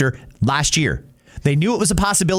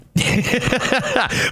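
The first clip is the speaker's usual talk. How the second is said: short burst of laughter, higher; real laugh; 8 semitones higher than usual. Real, not acted. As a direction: laugh out at the punchline. Laughs at his own joke.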